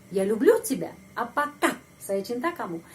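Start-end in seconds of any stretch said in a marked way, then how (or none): 0.1-0.9 люблю punched up, falling after it; stress on люблю. Shown as a demonstration. Teacher demonstrates question intonation, stress on люблю. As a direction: ask it by lifting the pitch on люблю. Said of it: "penekanan intonasi pada kata kerja люблю".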